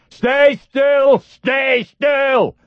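high, loud nasal yell. A narrator's reading. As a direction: yell it at full force. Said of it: "the voice yelled".

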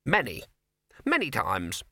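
high rabbit voice, grumpy; a high little rabbit, still sulking; sour rabbit. Acted. Rabbit counting sourly.

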